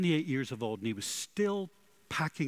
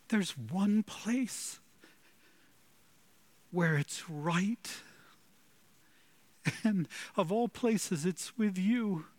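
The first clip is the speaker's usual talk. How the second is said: higher, slow, pleading prayer voice; fervent prayer; 8 semitones higher, slower than usual. Acted. Preacher praying to the Lord at the close, high slow fervent prayer. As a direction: pray it out slowly, lifting the voice.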